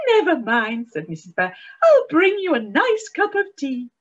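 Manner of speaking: high, loud, cheerful wife's greeting; chirpy Missus Bear. A narrator's reading. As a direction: sing out a bright good morning to her husband. Missus Bear comforting husband.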